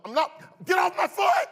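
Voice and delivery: high, loud shout, rising to a scream; frantic shout. Retold or imitated. Boy screams at his sister to get her car off his foot. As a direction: scream it, desperate for her to move.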